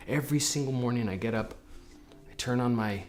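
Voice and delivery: normal talking voice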